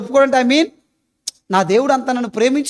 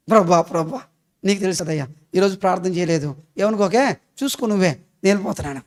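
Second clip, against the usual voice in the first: lower, softer offhand excuse to God; mock hasty prayer; 6 semitones lower, 5 dB quieter than usual. Preacher imitating a housewife's rushed prayer, low and soft. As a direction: toss off a lazy prayer, half-mocking.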